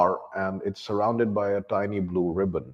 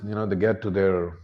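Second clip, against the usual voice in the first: normal talking voice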